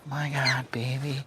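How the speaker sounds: soft whispered endearment; whispering softly to the panting dog. Real, not acted. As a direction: breathe it to the dog. Tender aside to the dog.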